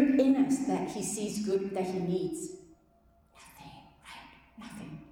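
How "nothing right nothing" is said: slow, barely voiced answer; whispered. Real, not acted. Speaker answers his own question about our worth in a slow whisper.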